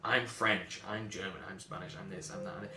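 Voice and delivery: low, soft recital fading away; entranced droning. Acted. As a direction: drone the list under your breath. Mimics people entranced by national identity.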